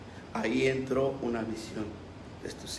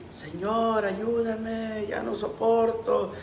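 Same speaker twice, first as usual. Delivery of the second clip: higher, pleading cry, drawn out slowly; anguished cry for help; 6 semitones higher, much slower than usual. Retold or imitated. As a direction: retell a tormented person's plea, slow and high. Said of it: "gritaba y decía". (súplica angustiada, aguda y lenta)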